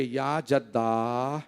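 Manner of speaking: low, soft, drawn-out imitation of weeping; tearful lament. Hussain at his grandfather's tomb, voiced as tearful lament. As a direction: lament softly, stretching the words of address. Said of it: "बुलंद आवाज़ से रो के कहने लगे".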